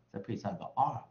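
ordinary talk, nothing marked

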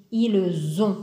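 "Ils ont" with a z link: ilz-ont; liaison. Shown as a demonstration. Teacher modelling "Ils ont" with the liaison. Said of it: "on doit faire la liaison".

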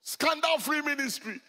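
loud, high mock admiration; admiring congregants. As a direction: gush loudly in imitation of admirers. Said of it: "so people always say".

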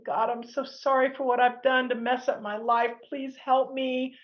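pleading prayer. Retold or imitated. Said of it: "I'm crying out to God going".

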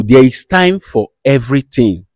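ordinary talk

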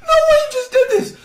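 high, shouted "No way"; loud disbelief. Real, not acted. Repeats reaction louder.

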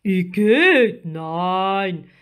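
loud, disgusted giant octopus; outraged creature voice; indignant. A narrator's reading. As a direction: exclaim it big with a swoop on the first word. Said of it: "rief der Krake empört".

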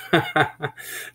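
short acted laugh; amused chuckle. Husband amused by the dragon question, chuckling. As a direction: chuckle at her alarm.

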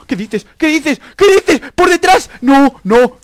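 building to high, loud cries; outraged, incredulous yelling; escalating disbelief. Real, not acted. Killed from behind.

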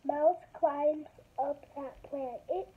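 slow, careful picture-book reading; reading to children. Acted. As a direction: read each page slowly to kids. Reads picture book aloud.

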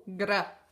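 gra said as one blend, the G running into a tapped R; gra sound. Shown as a demonstration. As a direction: join G and R in gra. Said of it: "la G y la R, que dice". (pronuncia gra uniendo la ge y la erre)

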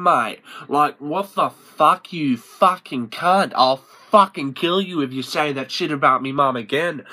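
loud, hammed-up outraged rant; acted fury. Bogan defending his mum, loud acted fury. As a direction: rage at him over the insult.

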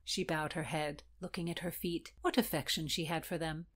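ordinary delivery

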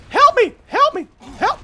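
high, loud squealed plea; panicked, very high yelps; desperate cries for help. Real, not acted. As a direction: shriek for rescue. Calls for help under attack.

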